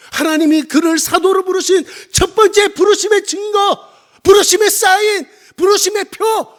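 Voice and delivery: high, loud pulpit proclamation; full-force preaching. Real, not acted. Preacher lists the signs of calling to congregation, full-force preaching. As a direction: punch out each phrase as a proclamation.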